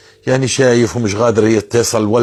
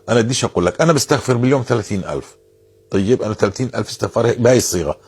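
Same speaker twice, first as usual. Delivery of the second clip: usual speaking voice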